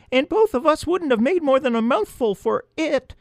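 very high chirpy mouse voice; chirping mouse. A narrator's reading. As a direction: chirp like a tiny field mouse. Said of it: "chirped the other".

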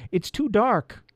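high, mildly frightened voice; a touch of fear, pitched high; note of fear. A narrator's reading. Elf below in the dark, note of fear. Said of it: "with a note of fear".

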